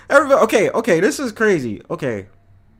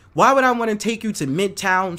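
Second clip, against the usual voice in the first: usual speaking voice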